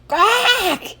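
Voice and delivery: high, strangled yelp of disgust; boy's revolted squawk; disgusted yell. Acted. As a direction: yelp out in horror, high-pitched. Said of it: "yelled Andrew".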